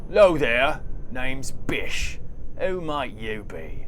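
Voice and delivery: Bish in character. Acted. Voices Bish, a gruff guard.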